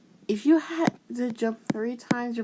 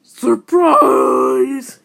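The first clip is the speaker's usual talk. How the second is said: big yell, much louder; surprise yell; 3 semitones higher, 12 dB louder than usual. Acted. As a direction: burst out like a jump scare. Jump-scare yell at the viewer.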